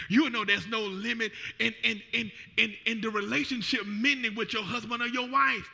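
normal talking voice

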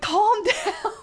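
high, giggling hush; trying to settle him while laughing; laughing calm down. Retold or imitated.

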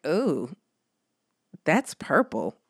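put-on a child eyeing her plate with suspicion; mock-doubtful daughter; unimpressed kid. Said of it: "she was like".

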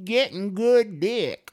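put-on high, slow old woman voice; old lady voice. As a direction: stretch it out like a cheeky granny. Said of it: "And she goes".

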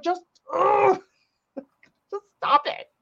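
high, exasperated mock plea; fed-up fan rant, half laughing; exasperated outburst. Acted exasperation at actor's accents.

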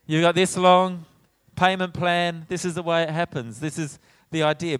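hammed-up dad laying down terms; grudging dad setting out a payback plan; grudging parent. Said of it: "And go".

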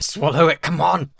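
loud urging to himself; straining to swallow, yelling; urgent shout. Retold or imitated. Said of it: "will see me just going".

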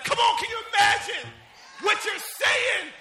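high, loud, stretched-out exclamation; exclaiming preacher shout. Real, not acted. Preacher asking congregation about saying amen, exclaiming. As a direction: burst out high to drive it home. Bursts out after the litany.

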